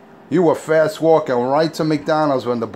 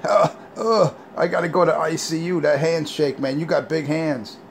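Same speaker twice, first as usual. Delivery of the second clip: higher, whiny wimp voice; mock whiny wimp; 5 semitones higher than usual. Mocking Richie whining about the handshake. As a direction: whimper it like a crushed weakling. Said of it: "You were like".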